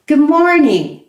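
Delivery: "Good morning" with the G sounded clearly; formal greeting. Shown as a demonstration. Teacher leading students in the formal "Good morning". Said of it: "First, formal".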